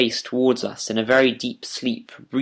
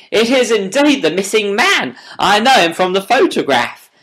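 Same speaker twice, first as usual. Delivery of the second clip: much higher and louder exclamation; excited; 9 semitones higher, 7 dB louder than usual. A narrator's reading. Inspector recognising the missing man, excited and loud. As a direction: burst out in amazed recognition. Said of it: "cried the inspector".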